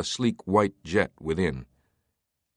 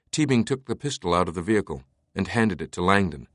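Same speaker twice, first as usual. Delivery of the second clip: usual speaking voice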